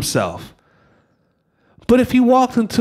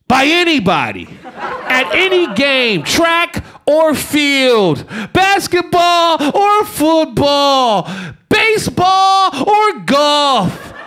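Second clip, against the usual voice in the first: building proclamation; 5 semitones higher, much slower than usual. Acted. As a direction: pound each sport out like a hype announcer. Building sports-hero proclamation.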